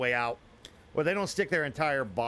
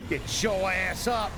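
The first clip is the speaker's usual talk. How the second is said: urgent shout; 6 semitones higher, slower than usual. Real, not acted. Urging in a fight.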